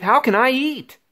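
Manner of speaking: ordinary delivery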